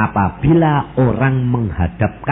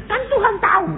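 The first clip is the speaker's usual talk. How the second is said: high, mocking whiny excuse; pitched-up mock quote of a believer dodging prayer; faster than usual. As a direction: squeak it out like a lazy excuse. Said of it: "Jangan bilang".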